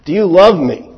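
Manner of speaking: Jesus asking again. Acted. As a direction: push the question home.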